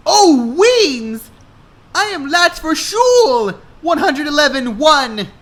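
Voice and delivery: high, loud flustered cry; panicked girl. A narrator's reading. Hannah panicking that she is late for school. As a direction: panic about being late.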